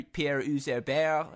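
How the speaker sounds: slow, drawn-out French pronunciation of "Pierre Herbert"; French accent. Shown as a demonstration. Showing off a French accent on a player's name. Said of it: "that was a nice little accent you had there".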